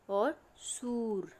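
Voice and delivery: सूर drawn out very slowly with a long ऊ; long u shown. Shown as a demonstration. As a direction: hold the ऊ in सूर long and slow. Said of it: "ये अंतर आता है pronounce करने में". (सूर में बहुत लंबा, धीमा ऊ)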